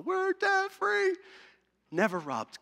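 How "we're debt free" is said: high, drawn-out triumphant cheer; imitated celebration yell, pitched way up; celebratory cry. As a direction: call it out like winners, stretched long. Callers celebrating being free of debt.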